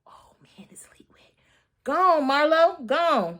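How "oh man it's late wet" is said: unvoiced whisper. Real, not acted. Vlogger whispering after the excitement. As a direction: breathe it out under your breath. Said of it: "whispered aside".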